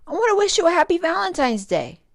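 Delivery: loud, high and fast call; calling after. A narrator's reading. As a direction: hurry the words out after her. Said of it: "Chomp called out".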